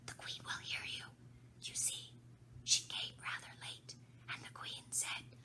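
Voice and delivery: unvoiced fearful whisper; the Rabbit keeps whispering in fear to Alice; frightened whisper. A narrator's reading. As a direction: keep it a scared secret. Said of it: "whispered in a frightened tone".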